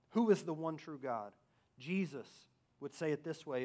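usual speaking voice

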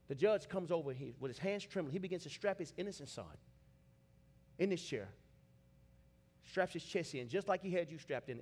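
normal talking voice

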